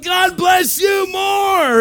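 very high, stretched-out holler; shouted reply blessing. Retold or imitated. Pastor returning the locals' blessing, high loud holler. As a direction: outdo their greeting with a long shout.

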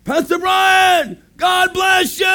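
very high, drawn-out holler; imitated street greeting. Locals greeting the pastor passing through, high shouted hail. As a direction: holler it like a crowd hailing someone.

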